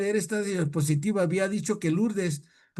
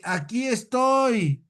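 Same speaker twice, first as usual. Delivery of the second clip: put-on high, drawn-out reply from a comic drunk; imitated tipsy holler; drunkard calling out; 5 semitones higher, 3 dB louder, much slower than usual. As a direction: call back slowly, stretching the last word. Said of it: "el borrachito dice".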